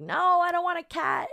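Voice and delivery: high, lightly voiced grandma saying no; a hint of a fussy grandmother refusing; grandma's refusal. Grandma turning down the cat at her door, high and fussy.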